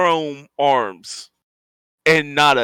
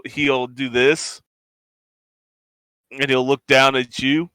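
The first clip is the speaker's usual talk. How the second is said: ordinary talk, nothing marked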